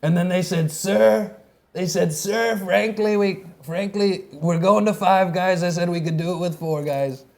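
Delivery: hammed-up imitation of a president's anecdote; storytelling president impression. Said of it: "Every story he tells, he goes".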